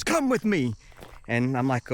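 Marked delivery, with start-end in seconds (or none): 0.0-0.7 high-pitched stern command; imitated angry order. Guard detains traveler after repacking, imitated angry order. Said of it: "angrily kind of detains me".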